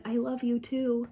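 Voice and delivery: soft, tender answer; mother answering her daughter softly, tender; tender reply. Acted.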